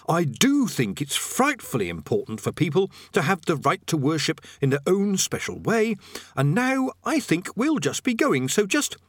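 lightly voiced nervous man making his excuses; Rincewind, polite and backing out. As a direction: ease your way out with polite chatter.

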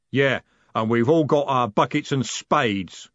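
ordinary delivery

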